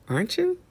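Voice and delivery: "aren't you" leaping up high at the end; questioning rise. Real, not acted. Confronting the teacher with a tag question, rising questioningly on "aren't you". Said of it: "I'm saying that like a question".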